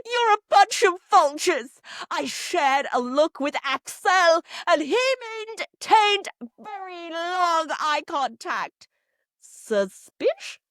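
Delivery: loud, high hammed-up accusation, slowing dramatically; theatrical outburst sinking into drawn-out suspicion; Karine, outraged. Hypnotherapist attacking the round table, mock outraged. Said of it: "hypnotherapist Karine".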